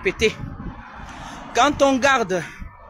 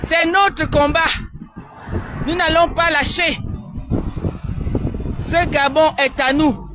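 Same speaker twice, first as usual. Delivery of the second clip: rally the crowd with a raised voice; rallying call; 4 semitones higher than usual. Real, not acted. Rallying call to join fight.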